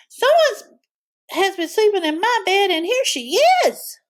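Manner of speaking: very high, squeaky cub voice; squeaky Baby Bear. A narrator's reading. Baby Bear showing the girl in her bed, high squeak. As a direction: squeak like a tiny excited cub.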